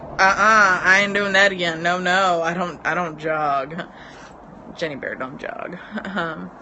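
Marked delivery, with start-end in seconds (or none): 0.0-3.8 loud, sassy "uh-uh, not again"; sassy refusal. Pretend. Telling viewers she quit jogging, loud sassy refusal. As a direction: flatly refuse the jog, with attitude. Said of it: "the next day you're like".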